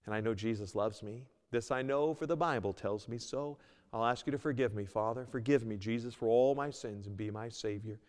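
soft, humble prayer voice; gentle model prayer; sinner's prayer. Pretend. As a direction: pray it quietly for the listener. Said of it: "acknowledge that you're a sinner".

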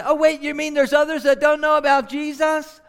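high, loud, incredulous question; astonished disbelief. Retold or imitated. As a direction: re-enact a stunned new believer's question. Astonished young converts learning of the unreached.

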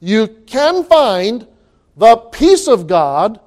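very slow, weighted you can find the peace of God; slow emphasis. Shown as a demonstration. Preacher repeating the promise slowly for the congregation. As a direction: lean into each word slowly. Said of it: "Uh, listen".

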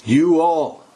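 "You all" with a loud, weighty "You"; stress on YOU. Shown as a demonstration. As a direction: hit "You" in "You all" hard. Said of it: "It's also plural".

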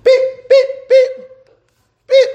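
loud, very high chirps; imitated alarm beep. Pastor mimicking alarms going off at him, loud high beeps. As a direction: squeal out piercing car-lock beeps.

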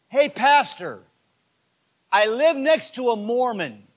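loud, high cry to the pastor; eager mock pleading. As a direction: call out like a fired-up church member. Acts eager church members pleading.

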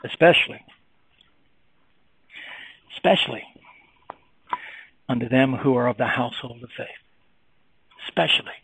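"Especially" repeated and set apart by long pauses, said soft and weighty; emphasis on especially. Shown as a demonstration. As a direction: linger on "especially" each time, pausing after it. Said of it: "he says and emphasizes".

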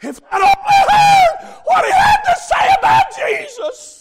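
high, full-volume scream of regret; imitating a desperate, regretful scream. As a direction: scream it like a lost soul. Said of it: "She's probably screaming".

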